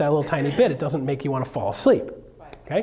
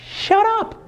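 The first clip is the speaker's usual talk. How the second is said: mock snap; 4 dB louder than usual. Snapping at imagined heckler.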